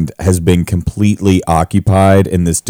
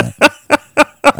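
laughing. Real, not acted. Laughing at own word slip.

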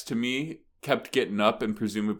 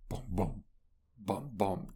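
drum beat imitation; 2 semitones lower, 10 dB quieter than usual. Imitating the show's opening drum beat, hushed booms. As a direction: quietly mimic a drum building tension. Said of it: "like, a drum beat".

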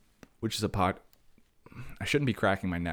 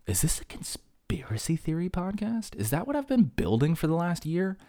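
mock paranoid question; 9 semitones higher than usual. Real, not acted. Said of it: "No, [name], it's not".

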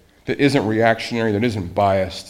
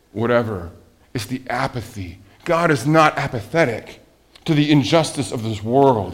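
normal talking voice

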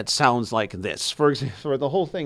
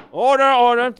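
high, loud call to order; mock judge; 11 semitones higher, 7 dB louder than usual. Shown as a demonstration. Demonstrating the gavel, loud high judge's call to order. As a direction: bang it out like a judge.

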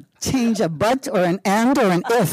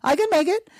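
much higher, breezy self-assurance; mock bravado; 11 semitones higher than usual. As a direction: toss it off like it's nothing. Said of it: "so I'm like".

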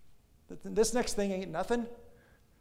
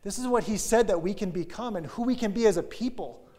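louder, rising rally cry; 5 semitones higher, 7 dB louder than usual. Retold or imitated. Caleb rallying Israel to take the land, loud and rousing. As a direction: rouse the people to go.

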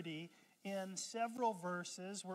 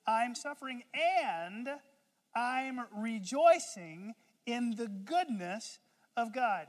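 slow earnest declaration; 4 semitones higher, 8 dB louder, slower than usual. Retold or imitated. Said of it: "He's saying simply".

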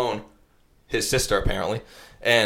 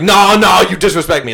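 much higher, louder mock drunk fury; wicked mad; 8 semitones higher, 8 dB louder than usual. Drunk sister insisting she was disrespected, loud and angry. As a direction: play her furious and drunk. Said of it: "Like, wicked mad".

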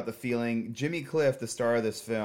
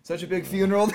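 mock Jamaican accent, higher and louder; 9 semitones higher, 6 dB louder, faster than usual. Host repeats the aunt's line to tease his cohost, mock Jamaican. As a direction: ham up the Jamaican accent, laughing. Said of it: "Oh, don't do a Jamaican accent".

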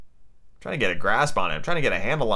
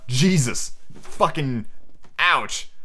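plain reading voice

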